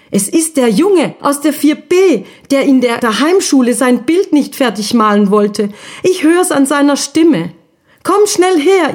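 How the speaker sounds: put-on high, urgent little ghost boy calling his sister; can't wait to show her; excited discovery. Guschuk entdeckt den Jungen, ruft Schwester. (aufgeregt und hoch, ruft die Schwester herbei)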